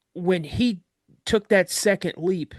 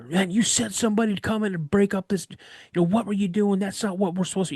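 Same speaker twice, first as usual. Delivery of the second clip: higher, heated mock argument; arguing instructor; 6 semitones higher, faster than usual. Instructor arguing with another instructor, heated. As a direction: complain to the other instructor. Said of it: "jawing at each other".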